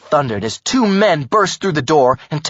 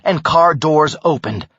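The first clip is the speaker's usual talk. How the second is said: plain reading voice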